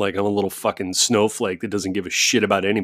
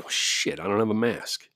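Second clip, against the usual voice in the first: muttering to himself; 7 dB quieter than usual. Retold or imitated. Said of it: "And so I went out and I'm like".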